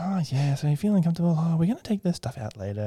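high-pitched, very happy bedside chatter; chipper surgeon. Retold or imitated. Woman surgeon greets patient, high chipper imitation. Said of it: "she is a very chipper woman, very happy".

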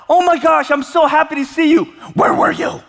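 high, loud burst of relief; overjoyed to find the lost child; relieved parent. Retold or imitated. Parent finding lost child, high relieved cry. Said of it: "I was like".

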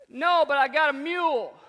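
loud, high farmer voice; country farmer. Retold or imitated. Farmer answering the coach, loud country holler. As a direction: holler it proudly across the field.

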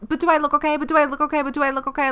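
high, fretful repeated question; mock worried friend asking again and again; anxious friend impression. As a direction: nag like an insecure friend. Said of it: "they're like".

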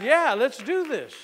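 loud, high and drawn-out yes; eager agreement. Retold or imitated. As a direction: jump at the offer, stretching the words.

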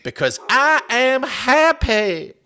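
high, slow, drawn-out Southern crowing; Southern drawl. Acted. Kicker crowing about getting paid, gleeful Southern drawl. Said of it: "give him the kind of southern drawl".

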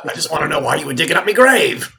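fast, high, loud Cockney grumble; indignant zombie in Cockney; [name]'s Cockney voice. Acted. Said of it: "That's the same voice".

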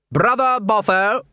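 high, drawn-out call; commanding cry from the boss; stern summons. Acted. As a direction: call him out imperiously, stretching the words. Head clown calls out Boffo sternly.

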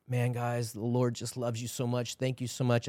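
soft, understated and heartfelt; retold tender gratitude. Pastor thanks friends after crying in prayer, softly tender. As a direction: imitate a man softly moved after prayer.

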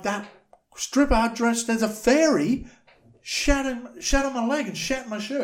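high-pitched indignant imitation; mock outraged complaint. Acted victim complaining about stripper.

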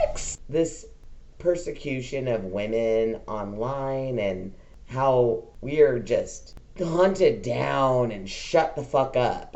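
ordinary delivery

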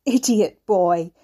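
a loud, slow scornful shout. A narrator's reading. Old man scorns Ricky with a loud shout. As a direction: spit the insult out slowly.

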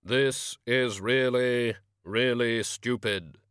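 very slow, booming, grave voice; Death's voice. Acted. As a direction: drag each word out like a tomb. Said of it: "Voices echoed faintly within the pipe".

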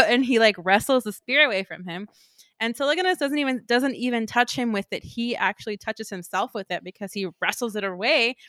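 ordinary talk

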